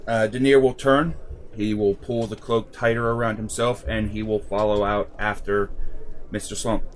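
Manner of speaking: ordinary talk, nothing marked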